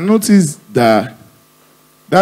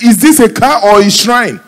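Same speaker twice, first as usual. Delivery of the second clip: incredulous question; 11 semitones higher, 5 dB louder than usual. Acted. Comedian questioning palm oil for a car, incredulous. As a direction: demand it in mock disbelief.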